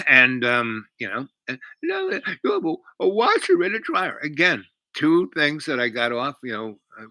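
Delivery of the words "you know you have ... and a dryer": high-pitched imitation of tearful amazement; mock crying. Crying woman discovering his appliances, mock tearful. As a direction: impersonate her crying, voice way up high. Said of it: "I hear her crying".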